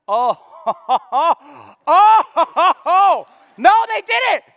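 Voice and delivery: loud, high, outraged oh-oh-oh; mock outrage. Preacher hamming up outrage to the congregation. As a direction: play up the shock at the men. Preacher reacts to wife harassed.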